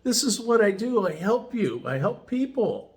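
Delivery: Rick's eager helper voice, lifted high; imitating Rick. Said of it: "He says".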